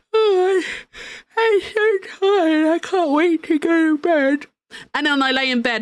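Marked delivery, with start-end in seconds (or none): 0.1-4.5 higher, slower mock-sleepy sigh; sleepy self. Streamer imitating her yawning self at the desk, mock-sleepy. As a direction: ham up being sleepy. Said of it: "and I'm like".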